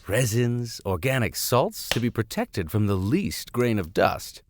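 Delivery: normal talking voice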